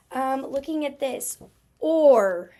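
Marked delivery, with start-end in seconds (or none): 1.8-2.5 or said loud and open; stretched, loud "or" for the class; sound demo. Shown as a demonstration. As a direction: demonstrate "or" with a round open mouth. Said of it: "notice what the mouth does here".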